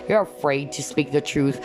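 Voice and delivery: ordinary talk, nothing marked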